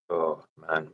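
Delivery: ordinary talk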